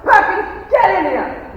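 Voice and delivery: loud, high shout for the butler. Acted. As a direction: bark the name across the house. Colonel shouts for his butler.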